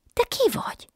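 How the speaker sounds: a sudden high leap of wonder; a little girl amazed to see a fairy. Acted. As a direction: jump way up in astonishment. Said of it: "ámult el a kislány".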